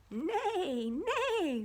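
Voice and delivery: high whinny on nay nay; playful horse whinny. Acted. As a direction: neigh it like a horse. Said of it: "whinnied the horse".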